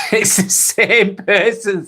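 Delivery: high, drawn-out words through a laugh. Real, not acted. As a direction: laugh while saying it, stretching it out. Amused reply to a viewer.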